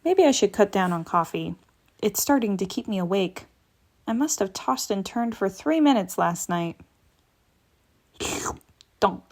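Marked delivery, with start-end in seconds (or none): none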